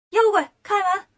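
high, loud challenge; calling out. Acted. Monkey hero hammers at the demons' gate, high loud call. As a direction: shout through a shut door. Wukong calls at closed gate.

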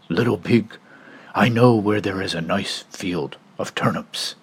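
low sly whisper; whispering wolf. Acted. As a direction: tempt him in a whisper.

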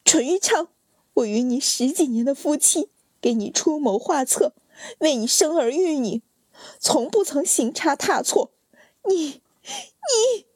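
high, rising to a breaking cry; anguished shout. Acted. Wife shouting at her husband before coughing blood, high anguished. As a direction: shout until you can't finish. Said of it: "大喊".

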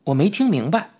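ordinary delivery

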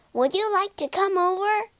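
high, sustained calling voice; calling over. A narrator's reading. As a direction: call across the water without shouting. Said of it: "called Rat".

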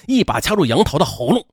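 plain reading voice

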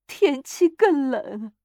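put-on high, loud, grieving old woman; tearful lament. A narrator's reading. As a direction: draw the words out in tearful grief. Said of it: "又哭了起来".

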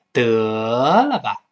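得了吧 with 得 stretched long and swooping; drawn-out scoff. Acted. Showing how Chinese express disbelief, drawn-out 得了吧. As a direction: stretch "得" right out before "了吧". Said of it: "你看，拉长".

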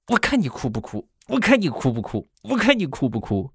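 put-on mother threatens to make the child cry; menacing mom. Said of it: "你们猜我妈是什么反应？".

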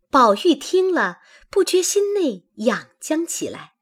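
usual speaking voice

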